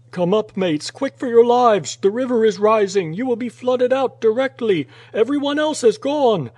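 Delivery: high, loud yelling down a shaft; urgent shouted warning. A narrator's reading. Dick warning mates below of the flood, frantic shout. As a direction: yell for their lives, pitch soaring.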